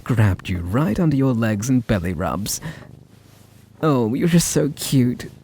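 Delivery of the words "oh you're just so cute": higher, slow gushing coo on just; cooing baby talk. Acted. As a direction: melt over a cute kitten. Gushing over the cat.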